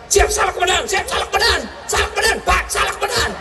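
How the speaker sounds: high, shouted yes sir my fault; cowed officer. Retold or imitated. Policeman shouting apologies to his commander, cowed. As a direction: snap it out like a scolded subordinate.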